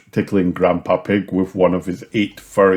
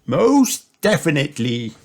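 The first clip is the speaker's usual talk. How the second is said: Grandpa Pig; 7 semitones higher than usual. A narrator's reading. Grandpa Pig's reply, voiced.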